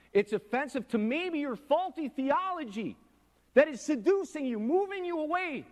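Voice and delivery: builds to a high, loud preaching cry; soaring pulpit exclamation; rising preached cry. Real, not acted. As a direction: climb in pitch and volume as you warn them.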